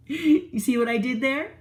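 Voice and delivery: usual speaking voice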